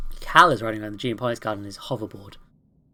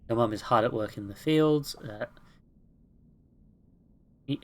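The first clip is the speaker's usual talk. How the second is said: usual speaking voice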